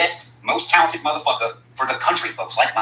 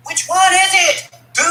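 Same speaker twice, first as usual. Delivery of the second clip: loud, high, drawn-out challenge; exasperated demand; 14 semitones higher, 6 dB louder, slower than usual. Real, not acted. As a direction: demand an answer, pitched way up and stretched. Exasperated at the contradictions.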